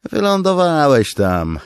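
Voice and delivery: high, loud mocking soldier; deadpan jab. Tall soldier mocking Beard's tall tale, high loud jab. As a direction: puncture his story. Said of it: "stwierdził wysoki żołnierz".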